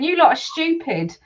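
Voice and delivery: ordinary talk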